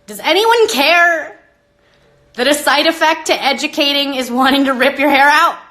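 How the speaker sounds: fed-up rant at the viewers; exasperated outburst. Real, not acted. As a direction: throw the question out in frustration.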